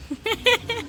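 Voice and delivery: high, soft chuckle; amused giggle. Real, not acted. Amused at the fearful child. (ಮೆಲ್ಲನೆ ಕಿಲಕಿಲ ನಗು)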